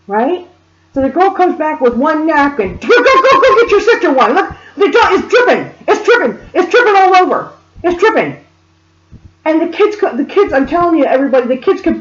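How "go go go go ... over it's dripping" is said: high-pitched frantic mother, retold; urgent, repetitive mock hollering; imitated screaming. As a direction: nag in a panicked mock screech. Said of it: "she started screaming at him the same way".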